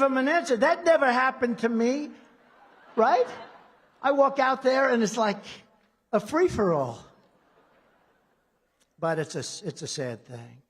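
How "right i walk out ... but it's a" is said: ordinary delivery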